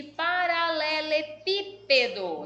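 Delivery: slow paralelepípedo, leaning on "pí"; stress on third-last syllable. Shown as a demonstration. Teacher introducing a long example word, slow and emphatic. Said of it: "antepenúltima sílaba é a mais forte".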